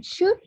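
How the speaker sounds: "should" lifted high with a rising tune; stressed SHOULD. Shown as a demonstration. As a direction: pitch "should" up like a question. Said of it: "the word should".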